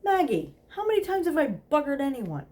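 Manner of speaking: high loud call through the door; bellowed to the madam; yelled out. A narrator's reading. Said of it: "yelled out".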